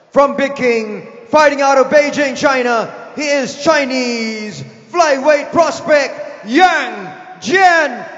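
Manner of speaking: booming, rising fighter introduction; ring announcer. Acted. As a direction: build to the name and shout it.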